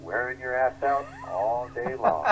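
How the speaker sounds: slow, high, laughing taunt; grinning taunt. Retold or imitated. Bird grinning at Cooper on the court, slow laughing taunt. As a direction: drawl it out through a grin. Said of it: "grins at him and goes".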